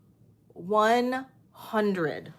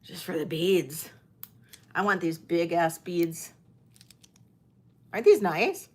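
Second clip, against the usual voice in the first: ordinary talk, nothing marked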